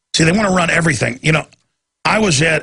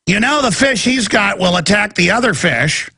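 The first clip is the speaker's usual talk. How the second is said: higher, lecturing busybody voice; mock know-it-all woman; 6 semitones higher than usual. Mocking the woman lecturing about his fish, higher voice. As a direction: play the prying customer explaining fish. Host mimics woman telling clerk.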